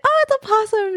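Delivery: imitating a sudden high-pitched squeal of approval; voice jumping up. Retelling the singer's thanks for a sticker, voice jumping up. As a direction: let the voice leap way up, imitating her. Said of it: "I don't know why my voice did that".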